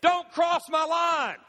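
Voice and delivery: put-on loud, slow kid shouting across the car; mock-childish yell at a sibling. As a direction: shout it like a kid guarding the back seat. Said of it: "So we say".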